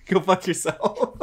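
slow, laughing curse; laughing retort. Real, not acted. Man laughs off the teasing about garage. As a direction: laugh it out slowly. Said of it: "laughing retort".

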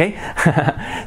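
short, breathy laugh; a light little laugh to oneself; amused chuckle. Real, not acted. Laughs at having no explanation.

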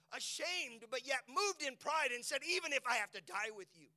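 high, loud, ringing preaching; preaching at full force. Acted. Pastor urging the church to hold on to God, full-force preaching. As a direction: shout it over the music like an altar call. Altar call preaching crescendo.

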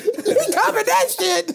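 laughing. Real, not acted. Laughing slow.

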